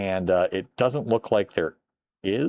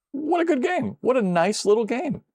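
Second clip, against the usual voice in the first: much higher, gushing delight; excited praise. Real, not acted. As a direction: rave about the game. Praising the game.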